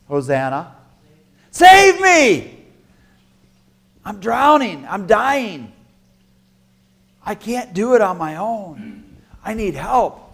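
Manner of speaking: high, pleading cry for rescue; begging to be saved, voice straining up; desperate plea. Acted. Believer crying out to God for rescue, pleading.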